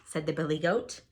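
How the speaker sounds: ordinary delivery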